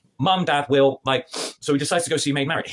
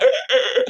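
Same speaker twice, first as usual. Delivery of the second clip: loud, higher choked grunts; hammed-up gasps after a throat punch; mock choking grunts; 10 semitones higher, 5 dB louder than usual. Robin punched in the throat, mock choking.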